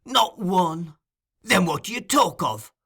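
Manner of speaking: angry retort. Acted. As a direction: fire it back in anger.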